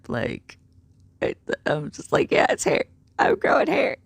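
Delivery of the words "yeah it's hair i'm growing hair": excited squeak. Retold or imitated. Proudly answering about facial hair.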